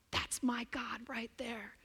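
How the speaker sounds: hushed, awed whisper, pointing something out. Acted. Believer nudging Scott about God, whispered. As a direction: lean in and whisper it like a secret.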